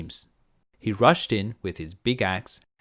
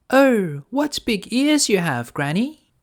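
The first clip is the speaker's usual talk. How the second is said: put-on much higher, bright-eyed little girl; 12 semitones higher, 6 dB louder, slower than usual. Girl answering the wolf, high and innocent. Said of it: "she said".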